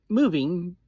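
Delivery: ordinary delivery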